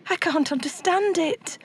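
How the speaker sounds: lightly voiced high little elf; a hint of a fretting elf; worried elf. A narrator's reading. Elf telling Santa he can't understand, lightly fretting. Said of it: "the little elf said".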